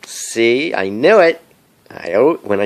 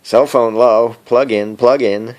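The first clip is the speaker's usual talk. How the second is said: phone low battery alert; 2 semitones higher, 7 dB louder than usual. Retold or imitated. Vlogger imitates his phone nagging him, robotic and loud. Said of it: "I hate it when it does that".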